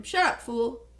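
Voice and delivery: snapping. Acted. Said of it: "Tino snapped at him".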